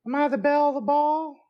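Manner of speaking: mock belle voice. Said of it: "that was pretty bad".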